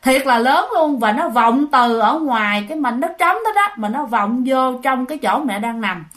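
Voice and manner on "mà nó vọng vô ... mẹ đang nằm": plain reading voice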